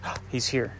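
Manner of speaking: very soft gasp of recognition; low-volume, awed realization; hushed awe. Acted. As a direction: breathe it out in hushed wonder. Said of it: "then this low whisper comes".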